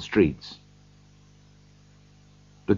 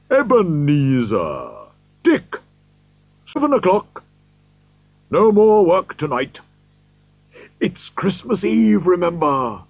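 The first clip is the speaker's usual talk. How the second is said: put-on jolly old employer calling out, higher and louder; rich happy voice; 7 semitones higher, 3 dB louder than usual. A narrator's reading. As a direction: call the clerks merrily to stop work. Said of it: "called out in a rich, deep, happy voice".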